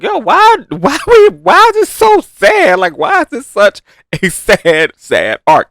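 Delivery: high, loud wail of disbelief; mock anguish. Fan reacting to the sad arc, high anguished protest. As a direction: plead to know why, pitch shooting up.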